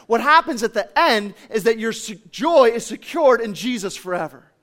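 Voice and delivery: emphatic sermon delivery, punching key words; impassioned preaching. Real, not acted. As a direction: urge the congregation with growing force. Preaches at full force.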